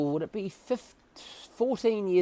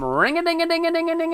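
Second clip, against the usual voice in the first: high, loud rapid-fire ringing noise; frantic bell imitation; 13 semitones higher, 7 dB louder than usual. Patient summons the nurse by bell, frantic ringing. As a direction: hammer out a manic bell.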